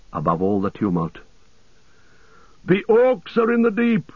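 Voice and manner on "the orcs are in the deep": cried out at high pitch; high alarm cry. A narrator's reading. Gamling warning the men of orcs, high alarm cry. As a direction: raise the alarm in a high, carrying voice. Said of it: "he cried".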